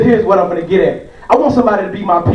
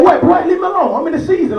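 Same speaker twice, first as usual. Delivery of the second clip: annoyed dad; 7 semitones higher than usual. Retold or imitated. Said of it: "I be like".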